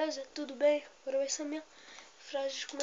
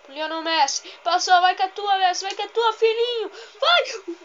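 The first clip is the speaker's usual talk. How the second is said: very loud shout climbing in pitch; frantic excitement; 5 semitones higher, 13 dB louder than usual. Real, not acted. Gamer urging Messi towards goal, frantic rising shout. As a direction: build to a high yell as the attack breaks. Commentating Messi breaking through.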